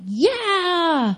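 high, drawn-out excited yeah; eager answer. Retold or imitated. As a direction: squeal it like an eager girlfriend. Retold eager answer to proposal.

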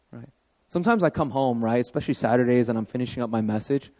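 usual speaking voice

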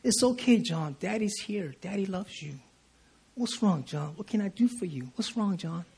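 put-on soft, hushed comfort to a crying baby; father hushes his crying son John, softly soothing; tender soothing. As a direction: soothe him quietly, gently. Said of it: "I go".

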